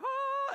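piercingly high, loud whoop; high worship yell. Shown as a demonstration. Preacher showing his wild worship noise, high yell. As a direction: let out a long, very high holler. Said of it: "yelling, like".

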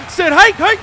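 loud, high hike call; yelling hike. Acted. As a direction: bark the snap count.

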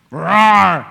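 a loud, hammed-up roar; mock lion roar. Preacher acts out the lion, loud mock roar. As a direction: roar like a cartoon lion at the crowd. Voicing the lion attacking David.

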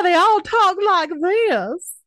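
high, slow mock old-movie Southern drawl; vintage movie New Orleans accent. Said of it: "That's like vintage movies New Orleans".

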